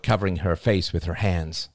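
usual speaking voice

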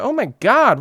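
loud high burst of amazement; amazed. Retold or imitated. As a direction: gasp it as it dawns on you. Retold sudden realization.

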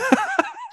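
a sudden squeaky burst of laughter; high laugh. Real, not acted. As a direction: crack up at the degeneracy. Laughs at friend's parlay plan.

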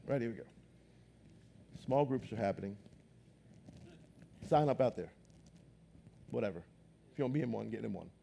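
low, soft, unenthusiastic drone; bored announcement. Shown as a demonstration. Pastor mocking a dull small group announcement, low listless voice. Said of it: "just say this is our small group announcement".

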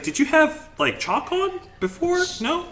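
high, squeaky mock question; high teasing question. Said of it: "It's like".

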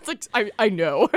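real laughter breaking through; laughing. Real, not acted. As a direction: laugh it out loud. Bursts out laughing.

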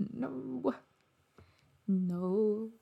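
hushed, appalled no; dismayed whisper. Real, not acted. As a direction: breathe it out in horror. Dismay at the story's turn.

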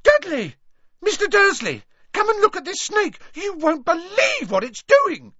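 high excited shout across the room. A narrator's reading. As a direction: yell for Dudley, thrilled. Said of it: "a deafening shout".

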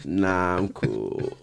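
imitated uninterested interviewee; monotone imitation. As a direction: drop the pitch and decline with no energy.

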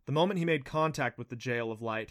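ordinary delivery

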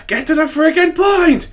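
high, loud frustrated yell at himself; mock exasperated shout. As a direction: shout at yourself to hurry up. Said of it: "I was trying to get to this point".

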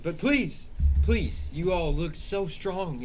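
high, slow, loud imploring; pleading father. Acted. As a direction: beg the strong heroes. Grieving father figure held.

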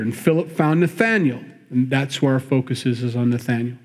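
ordinary talk

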